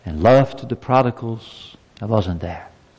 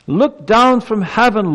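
much higher, louder, slow, crying out to heaven; bold pleading prayer; 10 semitones higher, 4 dB louder than usual. Acted. Preacher voicing the prophet's prayer to God, loud and slow. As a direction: plead with God at full force. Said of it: "Have you ever prayed like that?".

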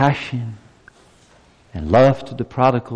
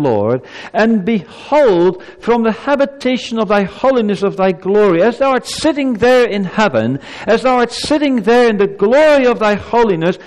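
much higher and louder, rising to a cry; bold pleading prayer; 11 semitones higher, 5 dB louder than usual. Acted. Preacher voicing the prophet's bold prayer, loud pleading cry. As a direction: demand an answer from heaven. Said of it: "Have you ever prayed like that?".

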